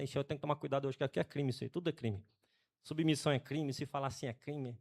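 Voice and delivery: quiet joking aside. Real, not acted. Pastor jokes to the church that it is crime, soft aside. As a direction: drop to a half-voice aside.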